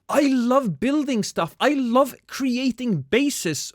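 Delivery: high, bright, eager gasp; excited. Real, not acted. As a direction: gush about building bases. Excited about building.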